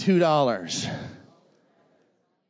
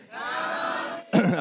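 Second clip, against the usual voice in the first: crowd shout; 3 semitones higher, 4 dB quieter, much slower than usual. Real, not acted. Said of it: "one laugh clear over there".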